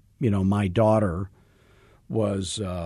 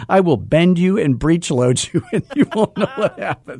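gleeful laugh; 7 semitones higher, 5 dB louder than usual. Real, not acted. Gloating joke to Jeremy.

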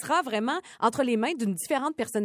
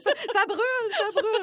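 shouting; 13 semitones higher than usual. Retold or imitated. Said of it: "En criant".

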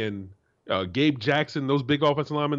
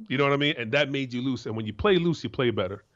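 ordinary talk, nothing marked

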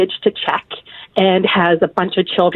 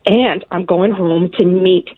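slow heartstring line; slower than usual. Retold or imitated. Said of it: "I'll say".

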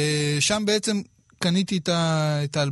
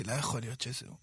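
much softer, retold thought to himself; hushed disbelief; 4 semitones lower, 14 dB quieter, faster than usual. Cyclist telling himself it can't be him, hushed disbelief. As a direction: drop right down, as if thinking it in disbelief.

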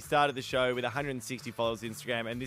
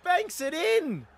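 excited announcer call; 3 dB louder, much slower than usual. Real, not acted. Grand final win celebration.

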